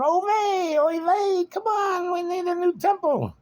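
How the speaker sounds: high, loud frantic shouting; yelling. Retold or imitated. Bettors at the rail screaming for their horse.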